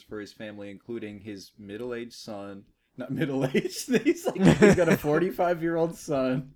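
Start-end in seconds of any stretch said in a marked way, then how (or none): 3.0-6.5 high, loud words through laughter; laughing. Real, not acted. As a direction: laugh while correcting yourself. Laughing correction mid-story.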